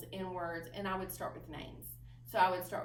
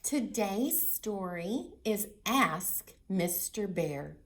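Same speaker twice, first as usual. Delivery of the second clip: slow storytime reading, louder; reading to children; 7 dB louder, slower than usual. Acted. Librarian announcing the book to children, slow and loud. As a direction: announce the title slowly, louder.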